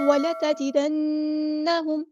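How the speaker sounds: وَلَتَجِدَنَّهُمْ with the نّ held in ghunna one alif long; slow tajweed reading of وَلَتَجِدَنَّهُمْ; Quranic recitation. Shown as a demonstration. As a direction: chant وَلَتَجِدَنَّهُمْ slowly, humming through the doubled نّ. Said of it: "اس طرح رکنا۔".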